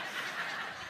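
breathy soft chuckle; quiet airy laugh. Real, not acted. Laughs at own story.

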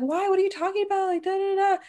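mock tearful. Retelling a bullied girl's reply to the boy, mock tearful. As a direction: act out a distraught girl in a high voice. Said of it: "breaking down crying".

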